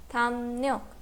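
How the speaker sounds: "tham ryok" said as tham nyok; R becomes N. Shown as a demonstration. Teacher repeats the change, "ryok" said as nyok. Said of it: "the sound of leer changes to nian".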